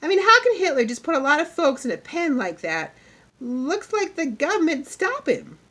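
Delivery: put-on high, loud child's protest; indignant pupil. A narrator's reading. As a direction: puzzle it out loudly from the back of the class. Pupil protests from back of room.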